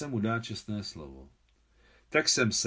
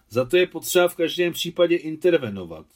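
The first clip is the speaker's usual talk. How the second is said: louder, higher, worked-up insistence; agitated; 7 semitones higher, 10 dB louder than usual. Acted. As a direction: speak up with urgent excitement. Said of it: "řekl vzrušeně Pavel".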